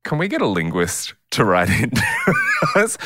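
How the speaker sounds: normal talking voice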